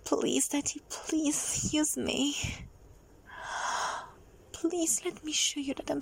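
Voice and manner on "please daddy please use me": ordinary talk, nothing marked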